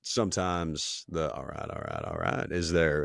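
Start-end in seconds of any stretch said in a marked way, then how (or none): 1.3-2.5 low, soft Texan twang; Matthew McConaughey. Retold or imitated. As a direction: drop into a laid-back catchphrase impression. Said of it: "Matthew McConaughey has his twang".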